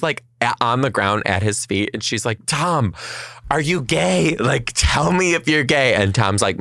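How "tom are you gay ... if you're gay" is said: pleading mother. Retold or imitated.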